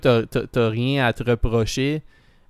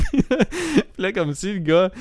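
laughing; 8 semitones higher than usual. Real, not acted. Laughing through the retelling.

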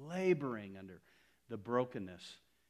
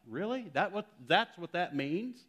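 high, disbelieving retort; incredulous; 7 semitones higher, 6 dB louder than usual. Retold or imitated. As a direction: scoff in disbelief, pitched up. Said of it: "I'm like".

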